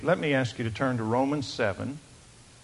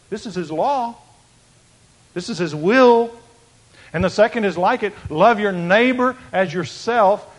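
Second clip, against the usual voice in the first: loud, raised preaching to the congregation; fervent preaching; 6 semitones higher, 9 dB louder than usual. Acted. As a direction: proclaim it, rising and louder. Preaching at full force.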